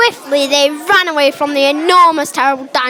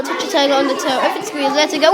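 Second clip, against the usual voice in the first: ordinary delivery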